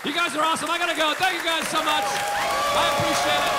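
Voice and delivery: high, loud showy farewell; comedian sign-off. Pretend. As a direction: wave off the crowd like a headliner. Mock stand-up sign-off.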